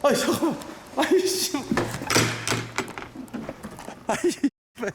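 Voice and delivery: high-pitched agitated cursing; excited swearing. Real, not acted. Broadcast goes off again.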